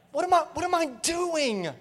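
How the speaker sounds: high-pitched, acted bewilderment; baffled self-questioning. Preacher models asking that self-assessment, baffled.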